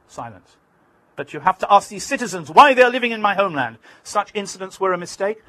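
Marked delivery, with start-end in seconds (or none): 1.1-5.4 heated reply. Retold or imitated. Arafat arguing back.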